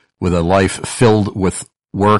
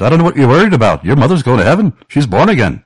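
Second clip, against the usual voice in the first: quick, raised Welsh accent imitation; 7 semitones higher, 5 dB louder, much faster than usual. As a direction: do Peter Jeffrey's thick Welsh accent. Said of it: "with his very thick Welsh accent".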